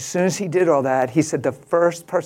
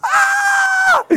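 very high acted shriek; hammed-up high scream; startled scream; 5 dB louder than usual. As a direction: shriek in overwhelmed shock. Acting out a startled awakening.

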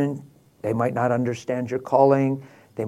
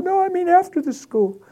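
much higher, teary woman's voice; pleading crying lady. Retold or imitated. Distraught woman begging the pastor to meet, high pleading voice. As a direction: beg him like a desperate stranger.

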